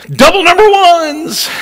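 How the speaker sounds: high, drawn-out comic cry; hammed-up triumphant squeal; mock road rage. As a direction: let the pent-up irritation burst out high. Mock gesture at offending driver.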